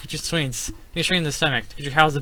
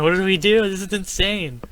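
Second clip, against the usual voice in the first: high, raised-voice bewilderment; pitched-up disbelief; exasperated outcry; 8 semitones higher, 7 dB louder than usual. Real, not acted. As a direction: cry out at a loss. At a loss in the game.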